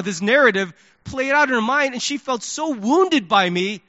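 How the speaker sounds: ordinary talk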